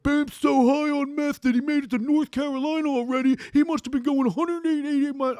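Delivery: high-pitched mocking imitation of a brother; mock high-voiced ranting; Tweety Bird rant. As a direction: rant in a silly, squeaky cartoon-bird pitch. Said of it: "on his little Tweety Bird rant".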